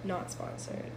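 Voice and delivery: quick whispered aside. Real, not acted. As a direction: breathe it out as an aside. Deadpan aside about the drink.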